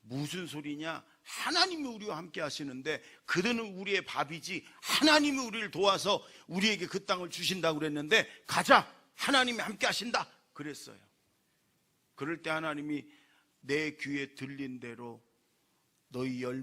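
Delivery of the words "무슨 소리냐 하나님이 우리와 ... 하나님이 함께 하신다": loud, high rallying call; bold faith. Retold or imitated. Preacher voicing Caleb and Joshua to the congregation, loud bold rally. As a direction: rouse them to go forward. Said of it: "그러나 갈렙과 여호수아는".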